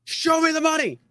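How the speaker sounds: very high, excited yelp on ME. Acted. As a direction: top the first take, go way up.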